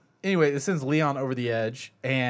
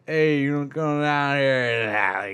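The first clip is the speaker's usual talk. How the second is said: put-on drunk Deckard voice; 4 dB louder, much slower than usual. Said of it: "and he's like".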